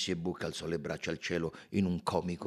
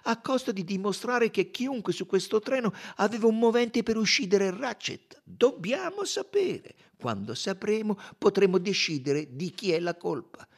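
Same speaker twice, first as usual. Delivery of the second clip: high, loud and emphatic; fervent, high-pitched detective; Poirot, insistent; 12 semitones higher, 6 dB louder than usual. A narrator's reading. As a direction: drive the argument home in a raised voice.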